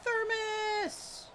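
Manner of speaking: high, urgent calling out; yelling Thermos. Real, not acted. Streamer calling out for a thermos mid-fight, high urgent yell.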